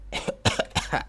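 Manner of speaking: choking cough on saliva; sudden choked coughing fit. Real, not acted. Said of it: "ah, me atoré".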